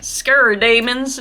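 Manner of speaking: put-on a light, playful copy of a strong Southern accent; imitating David's Southern accent. Vlogger mimics her guide's Southern accent, lightly. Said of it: "David obviously has a very strong accent".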